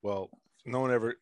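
ordinary talk

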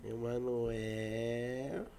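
very slowly stretched name; drawn out. Real, not acted. Drawn out name while searching.

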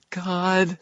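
high, panicked breathy gasp of a word; panting. A narrator's reading. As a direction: pant it out high in fear. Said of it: "said Fix, panting".

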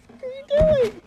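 high, loud imitation of an outraged parent; mock yelling parent. Parents driving by yell at their runaway son. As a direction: yell it like a shocked mom. Parents find him in the street.

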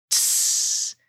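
short unvoiced hiss; steam hiss. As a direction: hiss like a snake. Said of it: "hissed back Caw".

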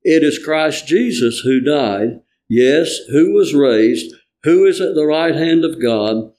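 ordinary delivery

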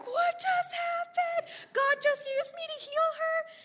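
high, loud, slow amazed cry; astonished disbelief. Retold or imitated. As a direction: squeal it out in utter wonder. Women amazed after the healing.

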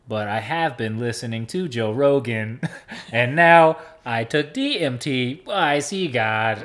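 hammed-up enlightened robot; toy robot voice. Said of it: "they still talk like this".

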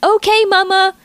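put-on high, carefree little-girl shout; child yelling back. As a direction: kid answering while skating off. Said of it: "Emily yelled back".

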